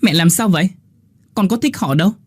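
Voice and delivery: high-pitched angry snap at mother; angry outburst. Acted. Linh shouts at her mother over the visitor. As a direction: lash out, suddenly furious. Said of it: "giận dữ ... mà quát lớn".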